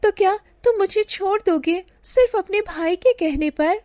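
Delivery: put-on young bride frightened of losing her lover, pleading; anxious plea. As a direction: ask in fear of being left. Sugandha fears being abandoned.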